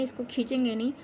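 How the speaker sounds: ordinary talk, nothing marked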